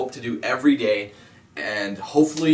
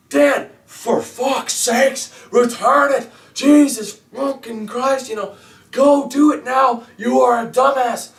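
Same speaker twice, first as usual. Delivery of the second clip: high, shouting mock rage, sometimes slow; imitating an enraged troll, high and loud; raging commenter; 12 semitones higher, 4 dB louder, slower than usual. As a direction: play the furious viewer screaming at Dan. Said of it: "completely raging out, swearing at me".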